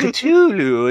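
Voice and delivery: Cthulhu drawn out slow and high, kuh-TOO-loo; a high, slow, prissy Cthulhu; posh mock pronunciation. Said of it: "sounds like you're sipping tea".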